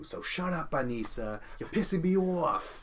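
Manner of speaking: high, loud imitation of an angry Bostonian; mock-furious Boston accent outburst. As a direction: snap it out in a put-on Boston accent. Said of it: "that's where the Boston accent starts slipping".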